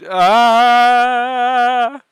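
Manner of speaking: long, loud, high held "ah"; exasperated scream. Pretend. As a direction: let out a comic yell of frustration. Exasperated yell at the trend.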